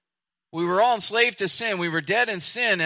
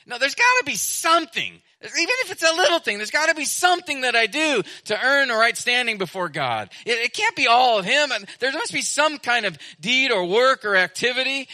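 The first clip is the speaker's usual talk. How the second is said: high, insistent mock protest; acted objection, raised and pressing; protesting objector; 7 semitones higher, 4 dB louder than usual. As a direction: imitate someone arguing back, pitched well up. Voicing people who resist free grace.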